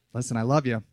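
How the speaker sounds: low, soft and tender; gentle reassurance. Real, not acted. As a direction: drop down low to reassure them. Reassures listeners right after yelling.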